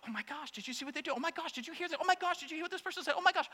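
breathless, fast, hushed mock panic; mock panicked viewer. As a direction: gasp it out like a frantic news junkie. Mocking anxious news viewers.

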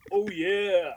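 lightly voiced mascot catchphrase; a hint of a big cartoon "oh yeah"; Kool-Aid Man. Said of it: "it's like the Kool-Aid guy".

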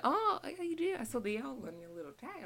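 high, loud friendly greeting; high friendly lady. Retold or imitated. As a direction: greet warmly as a kind customer. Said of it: "she was like".

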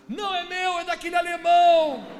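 high, very loud shout of denial; desperate yelling. Retold or imitated. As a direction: shout the blame onto someone else, acting it out. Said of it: "O cara começou a gritar". (grito alto de quem se defende)

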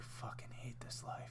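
whispered, defeated mutter; weary whisper. Retold or imitated. Pilgrim actor at home after work, weary whisper. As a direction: whisper like a tired man on the couch.

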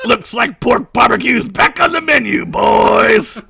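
pirate gloating to the mob, loud shout; gleeful shout. Acted.

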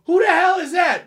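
high mock woman's voice, startled; storyteller mimics the upstairs woman at the door; imitating the woman. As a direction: squeak it like a startled neighbor woman. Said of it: "The woman go".

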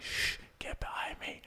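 hushed, slow whisper of a soldier; soft, breathy command to stay back; whispered order. Retold or imitated. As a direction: whisper it like a squadmate sneaking up. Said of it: "he says something like".

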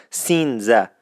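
سینزه said as "sinze" with an n added and the final h dropped; Isfahani pronunciation. Shown as a demonstration. Radio host repeating the Isfahani word for thirteen. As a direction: repeat سینزه slowly, local style.

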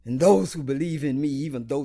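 loud, emphatic declaration of scripture; forceful proclamation. Acted. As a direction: preach it out loud with weighty pauses. Preacher proclaims Jesus' words to Martha.

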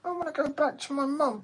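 squeaky high whiny dog voice; dog's voice. Pretend. Voicing the dog.

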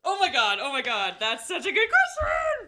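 loud, high squeal of delight; real excited outburst. Real, not acted. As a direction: burst out thrilled, stretching the last word into a squeal. Thrilled by the host's question.

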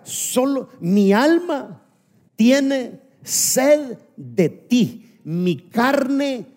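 muy lento, alto y sostenido; recitado lento y enfático. Shown as a demonstration. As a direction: arrastra cada palabra con pasión. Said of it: "dice el salmista". (lento y solemne, alargando las palabras)

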